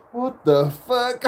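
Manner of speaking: high, drawn-out mock shock; stunned disbelief. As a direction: replay your baffled reaction, slow and high. Retelling his stunned reaction to her.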